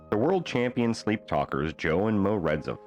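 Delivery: usual speaking voice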